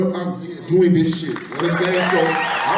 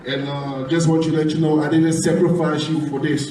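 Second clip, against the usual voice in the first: usual speaking voice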